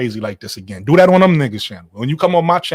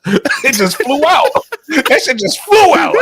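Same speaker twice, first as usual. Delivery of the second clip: high, drawn-out words through laughter; laughing hard; 6 dB louder, slower than usual. Real, not acted. Teasing a friend's slip to the panel, laughing hard. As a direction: crack up while saying it.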